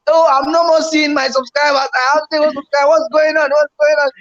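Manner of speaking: 2 high, alarmed viewer complaint; 3 voice a worried fellow creator; 1 quoted panic. Retold or imitated. 4 fellow streamer reporting lost subscribers, high alarmed.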